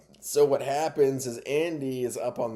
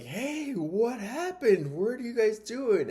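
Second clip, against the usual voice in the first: high-pitched, drawn-out mock surprise; surprised calling out; 13 semitones higher, slower than usual. Kid finding dad and brother on the patio, surprised. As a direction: call over in a high, puzzled voice.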